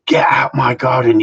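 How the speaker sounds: loud, slow, cried-out order; wife ordering the visitor off her land, shouting; retold angry shout. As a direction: bellow it like she's throwing him out. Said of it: "She said".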